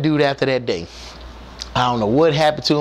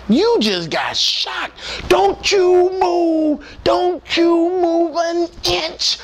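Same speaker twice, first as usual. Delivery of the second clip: high slow shouted orders; high drawn-out alarmed yell; alarmed shouting; 13 semitones higher, much slower than usual. Retold or imitated. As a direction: freeze him where he stands. Alarmed crew yelling at him.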